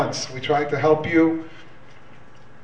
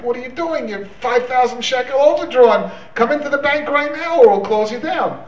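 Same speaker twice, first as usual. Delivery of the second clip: higher-pitched scolding of a bank manager; mock yelling; 7 semitones higher than usual. Bank manager phoning an overdrawn customer, mock yelling. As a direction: chew out an overdrawn customer, half in earnest. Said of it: "starts yelling at him".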